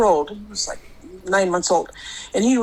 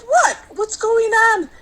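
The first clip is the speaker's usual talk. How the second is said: alarmed question; 12 semitones higher, slower than usual. Retold or imitated. Alarmed exchange over bloody pillow.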